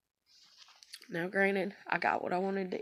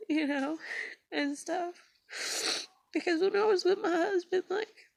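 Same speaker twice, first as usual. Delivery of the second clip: choking up as she relives being cared for; real crying; 10 semitones higher than usual. Real, not acted. Telling viewers about her husband's kindness, crying for real. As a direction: voice pitched high and tearful, pushing the words out through sobs.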